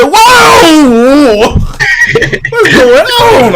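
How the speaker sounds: very high, slow, laughing shock; mom's shocked whoa. Retold or imitated. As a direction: squeal it out like a startled mom laughing. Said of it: "She said".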